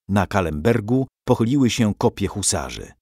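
usual speaking voice

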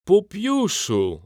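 booming call. A narrator's reading. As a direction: boom the name across the stands. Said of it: "zagrzmiał głos Fortunatusa".

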